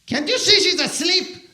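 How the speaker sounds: high, put-on voice of an annoyed mom; mother's retort. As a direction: squeal it like an offended mother. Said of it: "And she's like".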